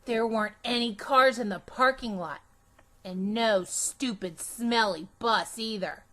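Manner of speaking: plain reading voice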